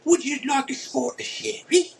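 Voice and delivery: toff British greeting, offering sherry; posh British accent. Retold or imitated. Showing off his best posh British accent to his friend. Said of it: "best British accent I've ever done".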